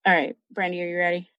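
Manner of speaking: normal talking voice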